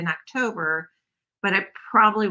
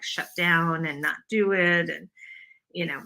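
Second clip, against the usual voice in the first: ordinary talk, nothing marked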